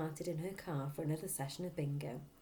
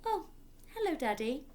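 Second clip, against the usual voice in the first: bright, higher, friendly greeting; pleasant; 10 semitones higher than usual. A narrator's reading. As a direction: greet him sweetly, like nothing is wrong. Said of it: "she said pleasantly".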